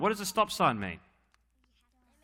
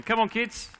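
calling out; 9 semitones higher than usual. Real, not acted. Pastor calling the children forward, raised call.

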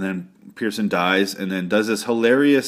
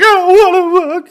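much higher, louder mock whine; whiny refusal; 9 dB louder than usual. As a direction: whine it like a scared kid dragging his feet. Corey refuses, voiced mockingly.

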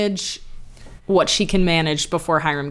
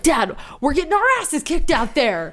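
higher-pitched mock pleading to dad; exasperated son; 7 semitones higher than usual. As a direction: imitate a teen complaining to his father.

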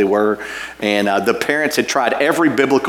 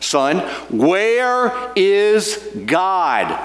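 higher, very slow stern question; sterner preacher; 8 semitones higher, much slower than usual. Retold or imitated. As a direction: press the boy harder. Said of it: "said more sternly".

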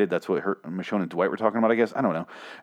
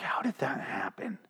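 hushed, whispered mock wonder; amazed; 13 dB quieter, slower than usual. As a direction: whisper it slowly in disbelief. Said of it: "You're almost, like, amazed".

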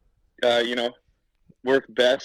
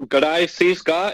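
put-on a light, slow Australian accent, louder and a bit higher; Aussie accent; 4 semitones higher, 6 dB louder, slower than usual. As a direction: greet him in a slow, mock Australian accent. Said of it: "was wondering if you got the Aussie accent yet".

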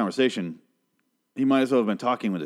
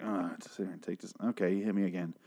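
soft, bored deadpan; much quieter, unimpressed; barely bothered, soft and dry; bored unbothered; 2 semitones lower, 7 dB quieter than usual. Retold or imitated. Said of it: "He's like".

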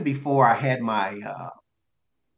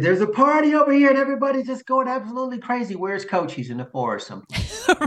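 excited partygoer; 12 semitones higher, faster than usual. Retold or imitated. Imagined partygoer calling out.

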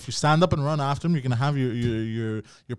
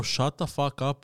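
normal talking voice

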